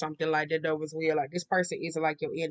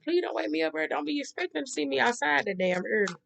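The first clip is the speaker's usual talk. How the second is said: whiny plea; 8 semitones higher than usual. Retold or imitated. Pleading not to wake early.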